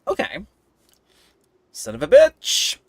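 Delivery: normal talking voice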